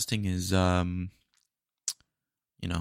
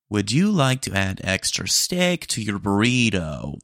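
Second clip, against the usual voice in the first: louder, slow automated question; slow drive-thru bot voice; robot upsell; 4 dB louder, slower than usual. Acted. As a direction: upsell like a cheerful machine.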